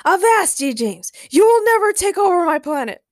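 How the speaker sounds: high, loud bombastic challenge; hammed-up royal defiance; heroic defiance. A narrator's reading. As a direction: declare it like a swashbuckling hero. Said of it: "Ray heroically leapt".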